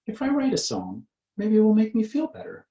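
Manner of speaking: put-on high-pitched character line in a children's story; storybook cat character. A narrator's reading. Cat's line voiced in story.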